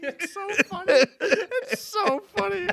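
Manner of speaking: high-pitched, helpless real laughter through the words; laughing hard. Real, not acted. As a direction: squeal it out while cracking up. Helpless laughter at the Borat bit.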